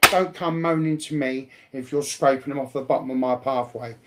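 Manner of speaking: usual speaking voice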